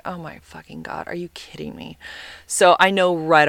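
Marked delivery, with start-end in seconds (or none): 0.0-2.0 very soft, hushed disbelief; dismayed under breath. Retold or imitated. First seeing her Tinder date is short, hushed dismay. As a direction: groan it to yourself, unheard. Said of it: "I'm like".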